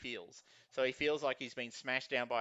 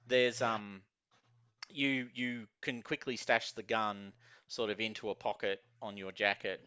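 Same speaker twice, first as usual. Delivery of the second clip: ordinary talk, nothing marked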